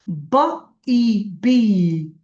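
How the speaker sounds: বা ই বি drawn out very slowly; slow phonics reading. Shown as a demonstration. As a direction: stretch বা ই বি, each sound held long. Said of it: "খালি মুখে উচ্চারণ করেন আর বলেন". (বা ই বি খুব ধীরে টেনে টেনে)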